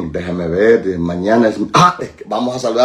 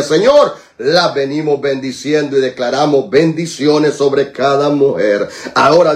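raised, insistent praying voice; fervent prayer; 6 semitones higher than usual. Real, not acted. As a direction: plead with God in urgent, repeated petitions. Leads a prayer for women. (oración ferviente con voz elevada)

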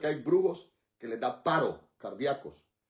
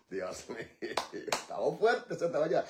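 mocking the devil; 4 dB quieter than usual. Shown as a demonstration. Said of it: "Ríase en la cara del diablo y dígale".

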